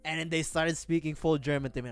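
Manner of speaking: ordinary talk, nothing marked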